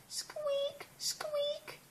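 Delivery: very high, tiny soft squeak; rabbit squeak. Acted. Reading to a small child, a tiny high soft rabbit squeak. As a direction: squeak like a little bunny. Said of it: "squeaks Mummy Rabbit".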